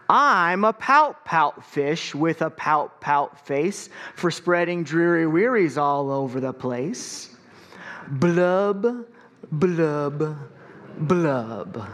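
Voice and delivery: slow, mopey sing-song fish refrain; glum pout fish. Acted. Gloomy fish chanting his refrain to his friends, slow and mopey. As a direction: drag out the gloomy fish's chant. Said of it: "there's a rhythm to this".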